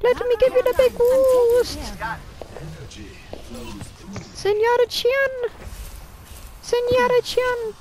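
Mercy voice. Pretend. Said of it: "and the Mercy voice".

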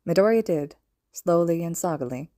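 ordinary delivery

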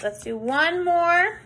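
ordinary talk, nothing marked

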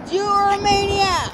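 high-pitched disbelief; high exasperated scold. Real, not acted. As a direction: scold a reckless friend. Scolding friend for running across.

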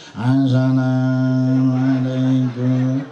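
very slow mournful greeting; a pious, sorrowful salam; sad drawn-out salam. Shown as a demonstration. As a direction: stretch it out sorrowfully. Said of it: "nyedih-nyedih".

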